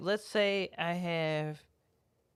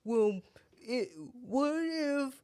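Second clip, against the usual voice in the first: mock what-if; 7 semitones higher, slower than usual. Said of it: "for you to be like".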